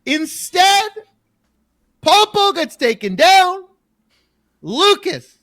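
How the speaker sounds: excited play-by-play. Real, not acted. Calling a comeback play live.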